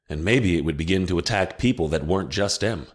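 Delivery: plain reading voice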